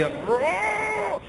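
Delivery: very high, thin pained cry; retold cry. Preacher recalling water in his ear, high pained squeal. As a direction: squeal it like it hurts.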